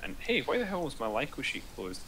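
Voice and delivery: softly spoken, mildly accusing question. Real, not acted. Player to the group over a closed sheet, mildly accusing. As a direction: ask it as if someone else is at fault. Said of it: "accusationally, like it was one of our faults".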